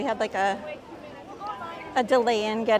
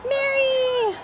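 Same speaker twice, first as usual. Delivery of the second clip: excited call; 11 semitones higher than usual. Real, not acted. Excited greeting to chat member.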